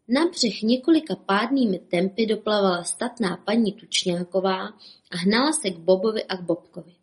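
ordinary delivery, nothing marked

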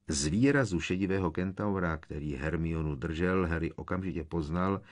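ordinary delivery, nothing marked

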